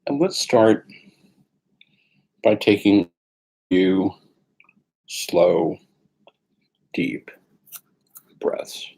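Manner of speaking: calm meditation guide, long pauses between phrases; guided meditation. Acted. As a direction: ease them gently into the sitting posture.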